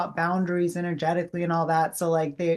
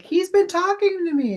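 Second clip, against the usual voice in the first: much higher, breathless with amazement; excited; 13 semitones higher, 4 dB louder than usual. Retold or imitated. Medium telling the daughter her father came through, excited. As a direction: tell her as if it just hit you.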